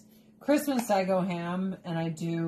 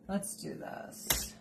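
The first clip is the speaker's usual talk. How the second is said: normal talking voice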